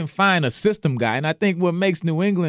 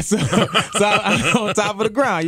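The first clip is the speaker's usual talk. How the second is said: laughing; 7 semitones higher, slower than usual. Real, not acted. Joking about being buried above ground.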